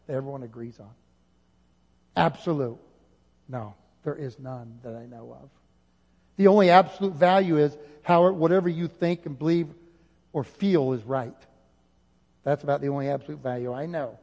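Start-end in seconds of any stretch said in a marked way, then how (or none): none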